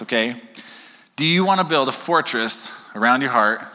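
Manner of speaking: normal talking voice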